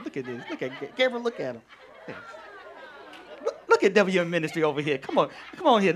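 ordinary talk, nothing marked

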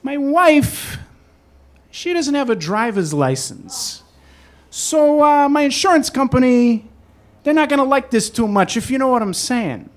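schmooze the stranger like a fixer; husband on the phone buttering up the cab driver; smooth-talking husband. Retold or imitated. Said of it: "And he says".

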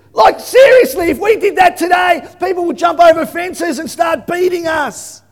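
high, loud indignant exclamation; preacher exclaiming to the congregation; emphatic outburst. Real, not acted. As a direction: hit the congregation with it.

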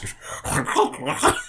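high, soft squirrel gibberish; mock animal-speak chittering; imitated squirrel noises. Doctor Doolittle talking to the shot squirrel, chittering. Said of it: "That's not what squirrels sound like".

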